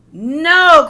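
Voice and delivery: sharp high shout of protest; cried out. A narrator's reading. James shouting at Henry to stop, loud cry. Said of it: "cried James".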